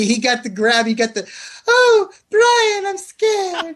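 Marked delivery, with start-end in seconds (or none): 1.7-3.8 put-on high, whimpering frightened girl; scared girlfriend voice. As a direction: squeal it like a terrified date.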